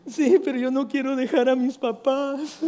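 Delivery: voz de niño algo más aguda; child voice. Retold or imitated. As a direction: imita suavemente a un niñito. El pastor imita al niño. (un toque de voz infantil)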